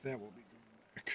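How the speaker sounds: quiet low mutter under breath. Real, not acted. Repeats line to self.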